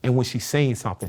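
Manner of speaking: usual speaking voice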